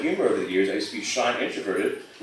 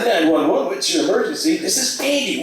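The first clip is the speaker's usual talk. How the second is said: urgent caller; 10 semitones higher, 4 dB louder than usual. Acted. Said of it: "Andy rushes to the phone".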